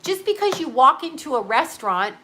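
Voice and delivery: ordinary talk, nothing marked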